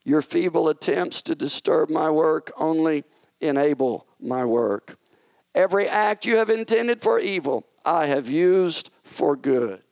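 a hint of a calm, lofty king rebuking; God the King. God coolly rebuking Satan's schemes, lightly voiced king.

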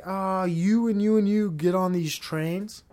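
hammed-up deity giving orders; mock commanding figure. Deity sorting people onto trains, slow and lordly. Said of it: "was like".

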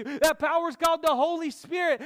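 shouted, fervent proclamation; preaching shout. Acted. Preacher shouting about the Holy Spirit, loud. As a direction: shout it out to the church. Climax of rallying sermon.

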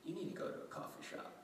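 fast, breathy whisper. Retold or imitated. Wife quietly telling her husband to go out, whispered. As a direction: murmur the suggestion under your breath. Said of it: "she says".

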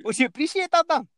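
high-pitched Scottish accent. Shown as a demonstration. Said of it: "I'll do Scottish".

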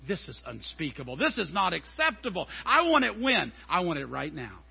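fast, indignant consumer rant; mock outrage. Amazon Prime member fuming over a late delivery, mock outrage. Impatient shopper ranting about shipping.

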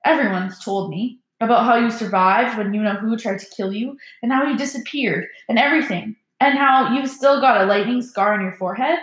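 usual speaking voice

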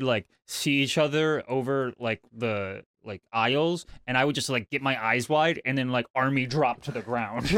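normal talking voice

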